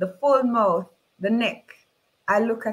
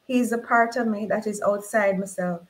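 ordinary delivery

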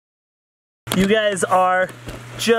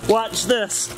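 usual speaking voice

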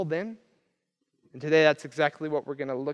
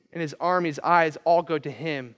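ordinary delivery

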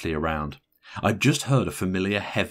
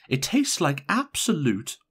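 slow, irritated complaint, pitched up; irritable; 9 semitones higher than usual. A narrator's reading. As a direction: grumble irritably, drawing it out. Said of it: "she asked irritably".

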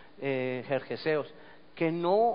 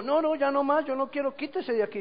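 higher-voiced, insistent refusal; protesting palm tree; 9 semitones higher than usual. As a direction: beg the vine to get off. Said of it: "Y le dijo". (palma que suplica, con voz más aguda)

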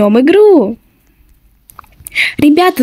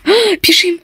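panicked; 6 semitones higher than usual. Pretend. Fleeing seeker.

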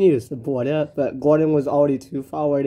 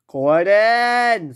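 high, drawn-out yell of the name; acted high-pitched scream; 6 semitones higher, 4 dB louder than usual. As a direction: yell his name and stretch it. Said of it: "screamed Edward".

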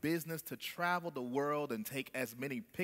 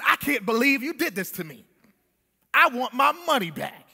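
loud, high, furious traveler; angry shout; 8 semitones higher, 11 dB louder than usual. A narrator's reading. Preacher voicing the traveler shouting at the painter. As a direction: yell it like you've been cheated. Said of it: "he angrily shouted at the painter".

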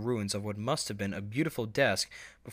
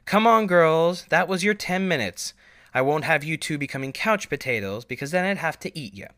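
higher, much louder motherly call with a playful threat; teasing mother; 7 semitones higher, 9 dB louder than usual. Acted. Mother switching off the TV for her daughters, loud playful scolding. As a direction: chide them jokingly to get off the couch.

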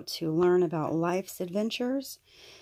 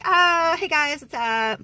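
high, loud, perky hello; playful voice for the heart glasses; bubbly greeting voice; 10 semitones higher, 8 dB louder, slower than usual. Pretend. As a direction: greet the fans like a cheery vlogger. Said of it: "The heart ones are like".

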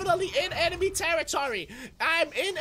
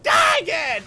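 high, loud outburst at losing; frustrated shout; 9 semitones higher, 7 dB louder than usual. Real, not acted. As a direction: yell it in exasperation. Frustrated at dying.

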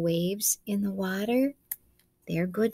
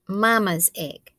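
ordinary delivery

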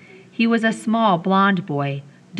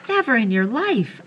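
gasping; 6 semitones higher than usual. Acted. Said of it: "I said, gasping".

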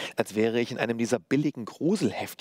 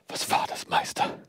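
whispered, broken question; brittle voice. A narrator's reading. Apprentice asking his master after the horror, brittle whisper. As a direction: ask it in a fragile whisper. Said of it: "fragte ich mit brüchiger Stimme".

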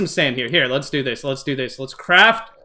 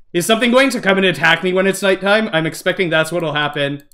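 ordinary delivery

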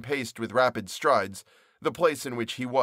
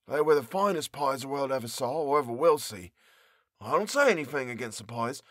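baker's voice; 3 semitones higher than usual. Acted. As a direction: voice the young baker, a bit put out. Character complains about the pies.

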